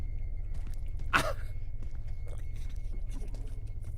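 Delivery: long, soft laugh at being doomed; helpless laughter. Real, not acted. Gamer realising he's screwed, helpless laughing. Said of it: "I'm laughing because of how screwed we are".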